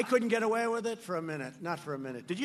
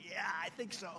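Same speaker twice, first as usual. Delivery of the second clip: mock-meek, much softer; timid mock running mate; 3 semitones lower, 11 dB quieter than usual. Mocking the running mate's fracking answer, meek and soft. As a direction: mumble it like an unsure dodge. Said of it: "It was like".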